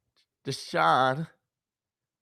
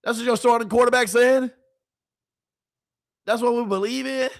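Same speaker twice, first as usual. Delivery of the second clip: higher and louder, piling on disbelief; incredulous; 6 semitones higher, 3 dB louder than usual. Real, not acted. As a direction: ask chat like it's absurd. Incredulous rhetorical questions to chat.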